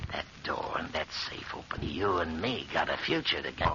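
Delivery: soft, low, unvoiced threat; menacing whisper. Gangster quietly threatens his partner, menacing whisper.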